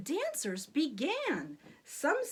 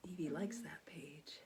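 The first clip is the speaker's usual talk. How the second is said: whispered aside; 9 semitones lower, 14 dB quieter than usual. Real, not acted. Parent whispering to Evie about the page. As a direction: quietly share it.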